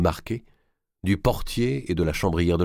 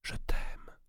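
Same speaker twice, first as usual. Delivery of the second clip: breathy secret whisper, almost no voice; 9 dB quieter than usual. A narrator's reading. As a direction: breathe it to a lover so no one overhears. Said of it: "elle lui chuchota dans un souffle".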